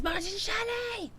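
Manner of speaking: high, drawn-out squealed name; shout-out. Retold or imitated. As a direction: sing out the name in a playful squeal. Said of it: "Jerry says".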